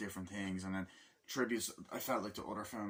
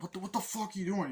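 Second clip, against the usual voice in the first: high and fast, a mock angry shout; retold angry yell; 10 semitones higher, faster than usual. The man yelling at Nicolas Cage's character, mock angry. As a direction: snap it like you're caught off guard. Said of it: "He's like".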